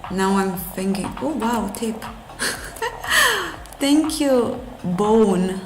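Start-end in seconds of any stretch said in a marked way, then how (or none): 2.1-3.8 short high laugh at a tip from chat; a quick surprised laugh. Real, not acted.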